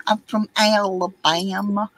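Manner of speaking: playful Southern Alabama drawl; Alabama accent. Retold or imitated. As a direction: lay on the Alabama accent, amused. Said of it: "I said".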